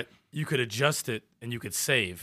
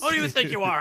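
mock angry; 8 semitones higher, 3 dB louder, faster than usual. Imagined winners cursing people out.

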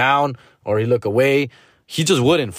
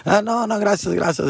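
higher, polite laughing refusal; dad laughing it off; 9 semitones higher than usual. Retold or imitated. Dad politely turning a woman away, high light refusal. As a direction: brush her off with a laugh. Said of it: "He was like, laughing".

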